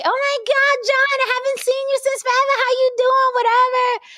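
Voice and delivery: very high, gushing squeal; other woman's voice. Retold or imitated. Woman greeting John whom she hasn't seen, squealed. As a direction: imitate an over-delighted greeting. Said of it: "I heard the bitch say".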